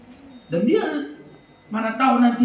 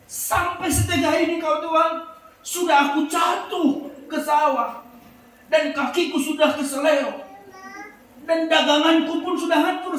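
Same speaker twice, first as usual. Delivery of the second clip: furious accusation; 7 semitones higher than usual. Retold or imitated. Mother left by the bus accuses God, furious. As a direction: rail at God that it is unfair. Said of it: "tambah marahlah ibu ini kepada Tuhan".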